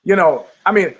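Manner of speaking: usual speaking voice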